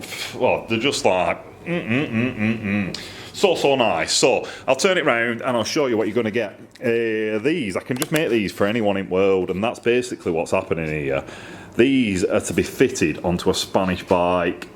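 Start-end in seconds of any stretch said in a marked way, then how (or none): none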